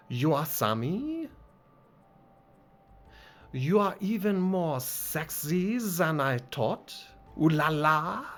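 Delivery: put-on flirty French accent with a giggle. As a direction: put on a lilting French coquette. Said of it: "this game wants me to do accents".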